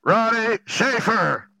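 slow, booming roll call; drawn-out mock summons; judgment-day announcer. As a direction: imitate a caller summoning the next in line. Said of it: "when they said up there".

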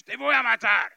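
high, very loud yell in Spanish; retold full-force shout; screamed threat. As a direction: act out the gunman screaming in Spanish. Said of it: "And he screamed at me".

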